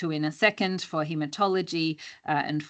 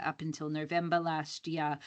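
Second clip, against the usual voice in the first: normal talking voice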